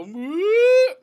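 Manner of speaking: high, loud mock wail; sudden acted-out howl; imitated kid's scream. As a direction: burst into a kid's delayed shriek. Imitating kids who suddenly see injury.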